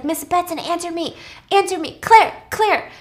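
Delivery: high, pleading acted calls; urgent calling. Virgil slamming on Claire's hidden door, urgent calling.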